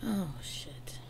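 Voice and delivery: hushed, shaken whisper; stunned whisper. Real, not acted. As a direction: breathe it out, caught off guard. Moved by lyrics.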